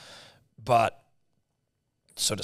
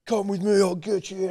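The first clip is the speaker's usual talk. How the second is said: put-on eager kid; 8 semitones higher than usual. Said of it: "This guy's like".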